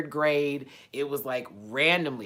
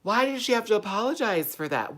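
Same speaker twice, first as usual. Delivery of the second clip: high-pitched disbelief; baffled and high; incredulous question; 8 semitones higher than usual. Real, not acted. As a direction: demand a reason, raised in pitch. Disbelief at the apology news.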